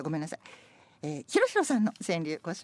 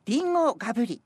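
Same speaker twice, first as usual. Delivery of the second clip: lively poem recitation; 7 semitones higher, 5 dB louder than usual. Acted. Reads playful senryu.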